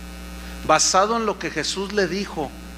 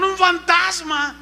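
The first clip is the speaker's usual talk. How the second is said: frightened cry; 9 semitones higher, slower than usual. Retold or imitated.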